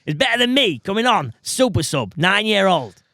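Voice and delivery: put-on pushy dad roping his kid into a scheme; put-on dad voice with swooping pitch; gack dad voice. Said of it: "It's my gack dad voice".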